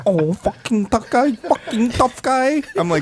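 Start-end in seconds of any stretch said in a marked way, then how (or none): 0.1-1.3 higher mocking hype voice; a mocking jab, rising at the end; mock tough-guy hype. 1.3-2.6 much higher, slow, stretched mocking voice; hammed-up ridicule; exaggerated mock tough-guy hype.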